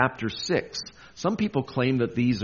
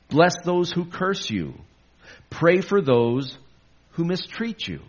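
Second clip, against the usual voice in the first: preaching scripture; 6 semitones higher, 4 dB louder than usual. Acted. Preacher proclaiming Jesus' command to the church. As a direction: proclaim each line to the congregation.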